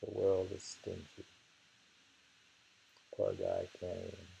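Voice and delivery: ordinary talk, nothing marked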